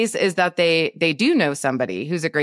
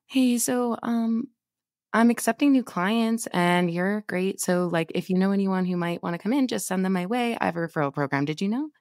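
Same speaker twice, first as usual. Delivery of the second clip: timid, softer, hesitant ask; afraid to be rejected; 3 semitones higher, 4 dB quieter than usual. Shown as a demonstration. Coach demoing the scared way to ask for referrals, timid and soft. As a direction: mumble the ask like you fear a no. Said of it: "Do you hear the difference in my, like, conviction, my voice".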